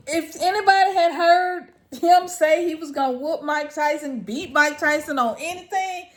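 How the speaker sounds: ordinary delivery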